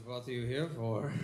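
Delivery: low, seductive stripper voice. Retold or imitated. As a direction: purr it like a stripper.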